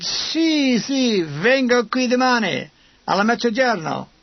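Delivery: high, eager Italian street seller; old Italian vendor. A narrator's reading. Vendor promising a piano tomorrow, eager Italian voice. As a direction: rattle off high-pitched Italian assurance, drawn out.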